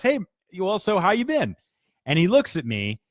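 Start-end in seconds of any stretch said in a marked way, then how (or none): 0.0-1.5 high, chirpy, overly casual greeting; fake-breezy chit-chat to cover the awkwardness; forced casual small talk. Nervous boss making small talk with employee in elevator, high chirpy casual greeting.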